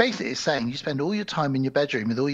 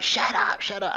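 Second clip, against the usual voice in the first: forced, partly voiceless goblin rebuke; mock-cross goblin hushing; goblins shushing; 5 semitones higher than usual. Goblins silencing one of their own, mock-cross. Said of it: "And they're like".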